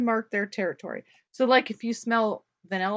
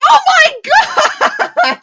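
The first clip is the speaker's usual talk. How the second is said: high, loud laughing shriek; shocked shriek; 13 dB louder, much slower than usual. Real, not acted. Host reacting to the beaver vanilla claim, shocked laughing shriek. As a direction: shriek it through a burst of laughter.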